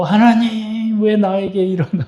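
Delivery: high, drawn-out complaining cry; whining complaint to God. Retold or imitated. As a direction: whine at God like a sulking child. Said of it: "하나님 막 욕하고".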